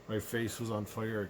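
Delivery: ordinary delivery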